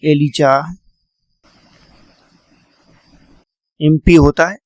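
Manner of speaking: name split with long pauses; slow dictation of the name. Shown as a demonstration. Teacher dictating the judge's name, slow and clear. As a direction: say the name slowly so it gets written. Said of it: "इनका नाम भी आपको याद रखना है".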